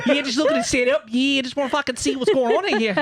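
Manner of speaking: high-pitched hammed-up Aussie-tinged Brit; badly faked British turning Australian; mock Australian-British accent. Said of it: "The Australian is really creeping up".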